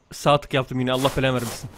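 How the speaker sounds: soft low grumble under the breath; muttered curse. Real, not acted. Gamer grumbling at his own misclick, a soft muttered curse.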